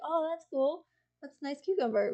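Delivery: a hint of a polite shrug; polite casual reply. Imagined friend politely complimenting the cucumber, lightly voiced. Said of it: "I thought maybe you'd be like".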